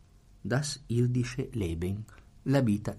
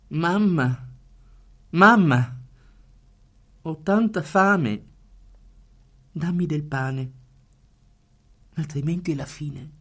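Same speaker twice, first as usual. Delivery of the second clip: put-on high, loud, begging child's voice; hungry child pleading; 6 semitones higher, 6 dB louder than usual. Lecturer reads the starving child begging mother, high loud plea.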